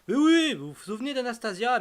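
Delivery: loud, high mock exasperation; mock insistence. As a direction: insist loudly, as if the viewer forgot. Mock insisting that viewers remember her.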